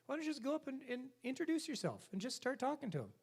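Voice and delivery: put-on chirpy helpful young salesman; young clerk voice. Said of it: "He goes".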